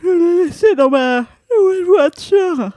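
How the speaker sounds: slow, yawning sleepy drawl. Shown as a demonstration. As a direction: yawn through the line, drowsy. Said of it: "je le refais avec la voix ensommeillée".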